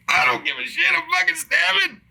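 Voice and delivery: mock frantic. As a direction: hype yourself up to charge out. Retold defiant outburst with knife.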